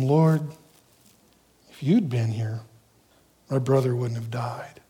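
low, hushed sorrow; soft, reverent plea with no blame; humble grieving faith. Acted. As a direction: say it gently, almost whispering at the end. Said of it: "it has a whole different intent".

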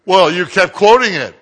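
loud protest. Retold or imitated. Organizer complaining to the preacher about the Bible, loud protest. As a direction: throw it back as an accusation.

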